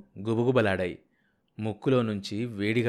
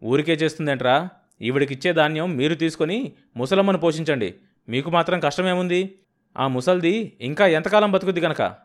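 angry argument; 6 semitones higher, 6 dB louder than usual. Acted. Husband argues back.

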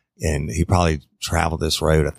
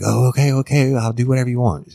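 high, louder panicked giving-in; freaked-out compliance; 8 semitones higher, 3 dB louder, faster than usual. Retold or imitated. Woman gives in to man with blade at her throat, freaked out. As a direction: imitate a frightened victim rushing to agree. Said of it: "she was freaked out".

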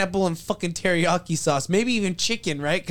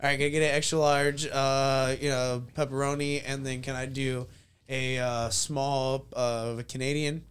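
quiet, low, halting pizza order; hesitant customer ordering; 6 semitones lower, 6 dB quieter than usual. Acted. Customer ordering at Papa John's, low and hesitant. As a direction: order softly, feeling your way through it. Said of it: "Papa John's and be like".

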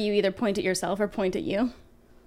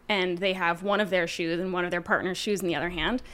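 normal talking voice

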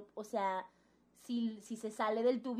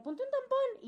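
friend's voice. Retold or imitated. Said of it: "y me decía".

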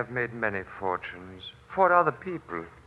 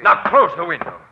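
loud, higher commanding threat; threatening; 5 semitones higher, 9 dB louder than usual. Acted. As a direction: order him in at gunpoint. Swami orders at gunpoint.

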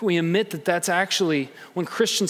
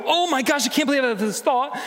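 mock panic; 8 semitones higher, faster than usual. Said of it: "and feel terrible".

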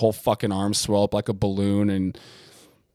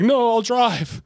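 high, louder squeaky insistence; mock stubborn patient; 12 semitones higher, 4 dB louder, slower than usual.

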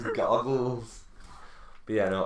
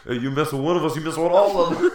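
pitched-up, rising mock-defiant shout; hammed-up tough crowd member, building louder; New Yorker bystander; 6 semitones higher, 7 dB louder, faster than usual. New Yorkers stand up for Spider-Man, loud mock defiance. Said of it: "you can play just the, the New Yorkers".

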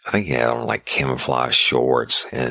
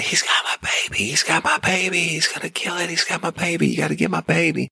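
high, frantic panicked pleading; frantic screaming. Retold or imitated. Mother begs the deputy to save her baby, frantic high pleading. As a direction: imitate a terrified mother begging for her baby. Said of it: "she's screaming frantically".

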